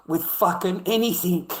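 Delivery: loud, high, furious burst; shouting the point home; outraged shout. Real, not acted. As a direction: hit it hard with real anger. Outraged about a child being hit.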